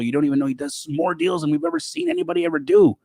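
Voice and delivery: high, fast, gushing rave; excited hype. Retold or imitated. Agent raving about the mystery dealer, high and fast. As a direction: gush about him like a fan.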